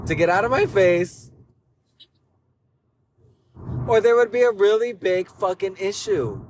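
rising anger, voice climbing; angry warning. Retold or imitated. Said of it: "tell him he had".

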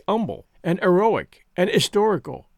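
umble, eroic, historical each begun on the vowel; dropped Hs. A narrator's reading. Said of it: "classes used to say".

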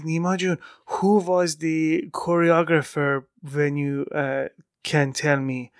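put-on slow Iranian-accented question. Mom asking her son about the choreographer, Iranian accent. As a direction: imitate an Iranian mom, taking each word slowly. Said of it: "this is how she speaks".

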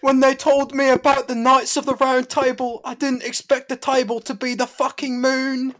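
high, loud, silly British voice; mock British accent. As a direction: riff in a put-on British accent. Riffing on the British accent impression.

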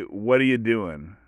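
ordinary delivery

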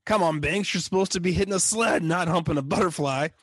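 mock a raspy, exasperated coach; imitated raspy scolding; Robert Loggia. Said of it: "say it in the Robert Loggia voice".

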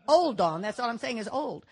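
doctor's answer punched out loud and final; firm retold reply. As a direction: shut the question down firmly.

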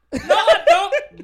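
high, slow, laughing mock old lady voice. Agent mimicking a grumpy caller who rejects the greeting, high and laughing. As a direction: drag it out high like a cranky señora through laughter. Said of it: "te decía así como".

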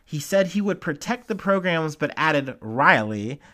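usual speaking voice

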